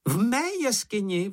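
high-pitched creature's exclamation; crying out. A narrator's reading. Čenich hears plans for his own cave, crying out. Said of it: "vykřikl Čenich".

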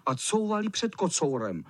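ordinary delivery

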